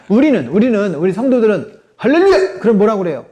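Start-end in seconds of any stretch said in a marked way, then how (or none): 2.0-2.6 high-pitched hallelujah cry; exclaimed greeting. Retold or imitated. Church member greeting another, exclaimed greeting. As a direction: call it out high and sudden.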